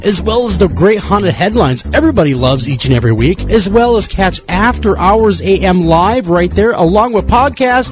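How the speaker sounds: promo read. Acted. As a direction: pitch the website with energy.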